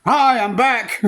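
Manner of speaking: high, bright call to the whole room. Retold or imitated. To the congregation turning round, a high called-out greeting. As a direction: pipe up to the crowd.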